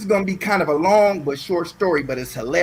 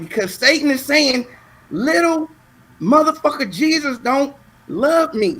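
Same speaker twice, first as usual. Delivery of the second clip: put-on child explaining himself; 6 semitones higher than usual. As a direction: imitate a pleading kid, pitched up.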